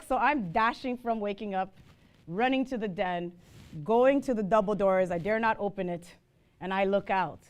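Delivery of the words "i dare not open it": usual speaking voice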